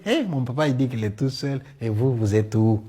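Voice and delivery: ordinary delivery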